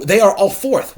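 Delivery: loud, high "They are all forth"; loud high call of "They are all forth". Acted. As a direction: call it out as Capulet. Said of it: "What ho!".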